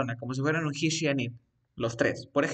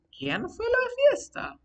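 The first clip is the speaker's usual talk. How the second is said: much higher acted question; asking a question; 4 dB louder than usual. Shown as a demonstration. Teacher demonstrates asking about the party, high questioning tone. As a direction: pitch it right up as a real question. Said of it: "Estoy haciendo la pregunta".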